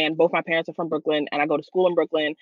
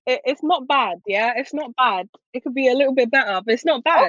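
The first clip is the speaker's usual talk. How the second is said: light mock British accent, a touch higher; posh-lite British voice on request; 5 semitones higher than usual. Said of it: "let's hear your British accent".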